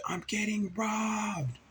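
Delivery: slow, high-pitched holler; yelling for help. Retold or imitated. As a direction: stretch it out to carry across a campsite. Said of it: "began to yell for help".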